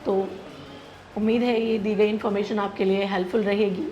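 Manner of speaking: normal talking voice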